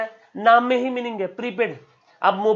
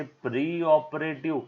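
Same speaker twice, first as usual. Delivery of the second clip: soft, slow and drawn out; tender, lingering naming of a term; affectionate; 4 semitones lower, 6 dB quieter, slower than usual. Shown as a demonstration. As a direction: say the term lovingly, stretching it out. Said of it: "हम लोग प्यार से".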